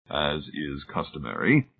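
soft, slow and dignified; quiet dignity. A narrator's reading. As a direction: say it with calm dignity. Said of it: "Tillingford said with quiet dignity".

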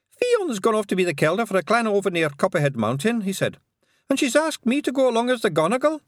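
high, loud Scots pixie; tiny Scots pixie, quick in places. A narrator's reading. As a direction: squeak it out like a wee Feegle. Pixie boasts news.

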